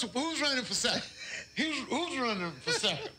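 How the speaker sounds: high, amazed mock commentary; excited. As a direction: call it like an astonished announcer. Mock race call, amazed.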